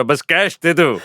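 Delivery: usual speaking voice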